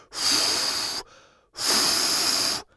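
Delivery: long unvoiced blow through the lips; blowing into wand. A narrator's reading. Fífa and the fairy blowing bubbles, unvoiced blow. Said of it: "foukali skoro hodinu".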